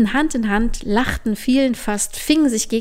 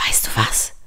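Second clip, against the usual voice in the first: ordinary delivery, nothing marked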